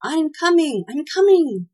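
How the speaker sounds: high, loud, hurried cry; sputtering panic. A narrator's reading. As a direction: call out in a fluster, pitched well up. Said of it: "sputtered Tw-, Tweedledee".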